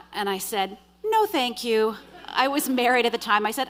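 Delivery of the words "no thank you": retold firm, higher and louder refusal. Woman refusing a man following her, firm. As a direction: turn him down loud and clear. Refusing the man's advance again.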